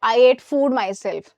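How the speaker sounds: very slow, clearly spaced words; slow wrong example. Shown as a demonstration. Teacher slowly reads a wrong sentence to students. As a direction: read the error out slowly for the class. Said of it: "गलत।".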